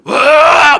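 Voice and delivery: long, high-pitched loud roar; possessed roar. Retold or imitated. Preacher imitates the possessed woman's male voice, roaring loudly. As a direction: let out a huge imitated demon roar. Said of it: "남자 목소리를 내요".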